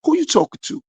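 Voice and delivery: high, sharp challenge; confrontational retort. Retold or imitated. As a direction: bristle and throw it back at him. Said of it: "I said".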